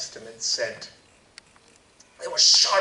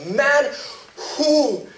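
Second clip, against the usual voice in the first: higher and louder, a stern retort; sharp rebuke; 9 semitones higher, 6 dB louder than usual. Acted. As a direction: cut him off sharply. Jesus rebukes the man.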